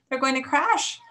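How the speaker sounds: urgent, high warning; alarmed gasp. A narrator's reading. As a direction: gasp it out in fright for your friends. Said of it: "gasped Stella Luna".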